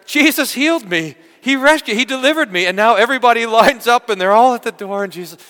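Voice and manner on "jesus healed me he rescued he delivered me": loud, high, joyful shouting; excited shout. Retold or imitated. Healed people telling everyone, loud and excited. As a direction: shout it out like someone just healed. Healed crowd spreading the news.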